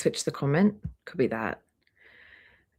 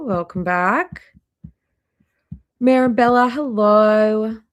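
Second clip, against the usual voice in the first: ordinary talk, nothing marked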